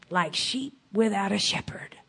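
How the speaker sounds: soft unvoiced aside; whisper. Real, not acted. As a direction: breathe it out in a whisper. Preacher whispers scripture echo.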